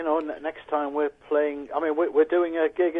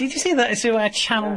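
excited; 9 semitones higher, 5 dB louder than usual. Real, not acted. Pleased with his own accent.

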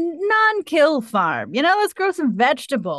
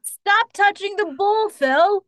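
higher, slow stern scold; 8 semitones higher, slower than usual. Acted. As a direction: tell him off slowly. Scolding the milker.

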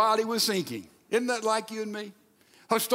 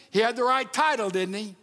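normal talking voice

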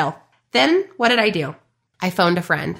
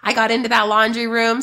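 ordinary delivery

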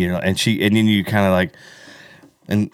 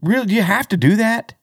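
indignant surprise; 13 semitones higher than usual. Retold or imitated. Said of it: "but I'm like".

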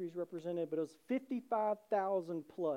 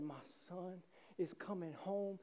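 soft, slow wonder; hushed, acted disbelief; moved father; 10 dB quieter, slower than usual. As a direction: say it tenderly under your breath. Father's heart melts seeing son.

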